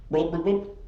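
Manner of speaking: playful sinking noise; imitated sinking plops. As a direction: sound out something dropping into water. Imitates object sinking in water.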